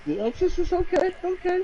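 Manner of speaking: high surprised pitch jump; pleased disbelief, voice shooting up. Real, not acted. Guessed answer confirmed.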